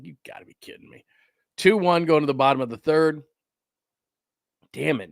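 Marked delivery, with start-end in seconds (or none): 0.0-1.0 mock whispered disbelief from the angry pitcher; imitating a whisper. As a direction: whisper it fast like he can't believe it. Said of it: "He's like".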